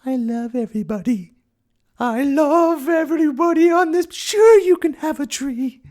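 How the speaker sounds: very high, loud majestic falsetto; Mother Earth. Acted. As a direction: trill grandly, bestowing gifts on everyone. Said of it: "She used to have a majestic voice".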